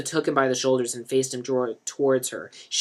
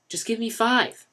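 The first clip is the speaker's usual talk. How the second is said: pleading; 9 semitones higher than usual. A narrator's reading. Denny begs for time.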